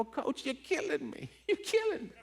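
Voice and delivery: high, cracking laugh-cry; overwhelmed, half sobbing, half laughing; laughing through tears. Real, not acted. Quoting himself to his coach, laughing through tears. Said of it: "You know why I'm crying?".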